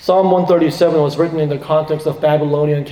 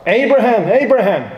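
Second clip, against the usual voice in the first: high urgent call of Abraham, Abraham; crying out; 6 semitones higher than usual. Acted. God calling to Abraham to stop him, urgent cry. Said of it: "God cries out".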